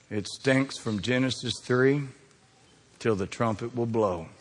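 low, hushed, slow sermon voice; slow solemn preaching. Acted. Preacher warns the congregation about sin, slow and solemn. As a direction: lay each phrase down slowly and gravely.

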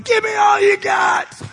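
high, slow defiant roar; Captain Dan shouting at the storm. Retold or imitated. Captain Dan yells up at the storm from the tower, defiant roar. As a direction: scream it up into the storm. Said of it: "and he's going".

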